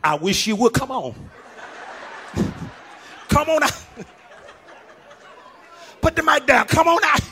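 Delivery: high, laughing dare to the pastor; mock challenge. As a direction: taunt him to put the mic down, half joking. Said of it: "some of you".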